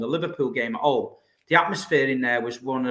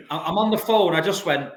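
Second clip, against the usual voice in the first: normal talking voice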